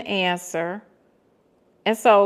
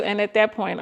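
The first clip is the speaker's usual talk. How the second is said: usual speaking voice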